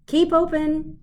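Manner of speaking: high loud order; yelled. A narrator's reading. Dentist yelling at the fox to keep open. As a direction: bark the command up into the mouth. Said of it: "yelled Doctor DeSoto".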